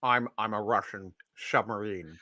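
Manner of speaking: put-on low, soft Scottish-accented Russian commander; Sean Connery. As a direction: play a submarine captain with a deep Scottish burr. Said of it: "best Scottish Russian accent".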